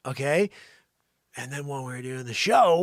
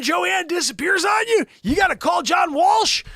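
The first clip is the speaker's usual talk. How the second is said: high, loud mock disbelief; exasperated rant; 9 dB louder than usual. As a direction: rant in hammed-up outrage at being abandoned. Acted outrage at Joanne's disappearance.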